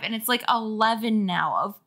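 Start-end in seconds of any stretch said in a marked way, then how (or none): 1.6-1.8 slow, drawn-out sass; slow exasperated teasing; sassy. Real, not acted. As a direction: roll your eyes at her. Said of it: "Girl, the store closed at five".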